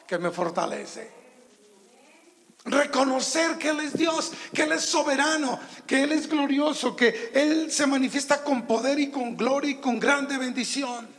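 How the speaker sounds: high, loud preaching build; rising sermon fervor; fervent preaching. Acted. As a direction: lift it up with fervor, full voice. Preaching at full force.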